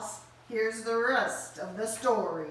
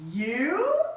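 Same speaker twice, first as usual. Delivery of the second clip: surprised; 7 semitones higher, 7 dB louder than usual. Acted.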